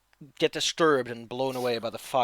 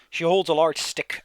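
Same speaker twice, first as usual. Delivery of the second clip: usual speaking voice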